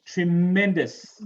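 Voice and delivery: "Tremendous" as tre-MEN-dous, slow with a strong MEN; stress on second syllable. Shown as a demonstration. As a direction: stretch and punch MEN in "Tremendous". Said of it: "The second".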